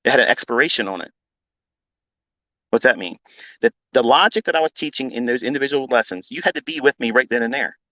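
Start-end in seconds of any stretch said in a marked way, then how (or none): none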